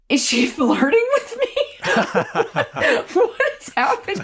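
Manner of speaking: high, laughing bewilderment; laughing disbelief. Real, not acted. Recalling Patti's odd friendliness, laughing high and baffled. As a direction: laugh through the confusion.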